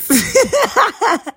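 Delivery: a sudden high laugh; high burst of laughter. Real, not acted. A daughter laughing as she recalls dad guessing right, high laugh. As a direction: laugh out, caught out and amused.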